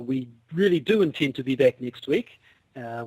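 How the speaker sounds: usual speaking voice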